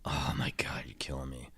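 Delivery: hushed, pained aside; exasperated whisper. Real, not acted. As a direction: breathe it out like torn between favorites. Agonizing over a tough choice.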